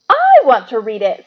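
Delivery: loud, slow, rising furious demand. A narrator's reading. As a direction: insist hotly that it's yours. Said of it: "said Harry furiously".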